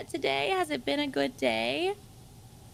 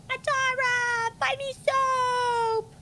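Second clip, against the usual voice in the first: much higher, slow whiny plea; imitating Matara; 11 semitones higher, slower than usual. Calling to the friend in her own high pleading voice. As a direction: beg for soap in a high put-on friend voice. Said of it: "You sounded just like her".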